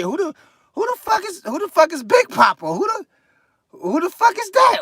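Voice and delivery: high, rising outrage that ends in a shout; outraged disbelief. Retold or imitated. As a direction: demand to know who Big Papa is. Outraged reaction to "Big Papa.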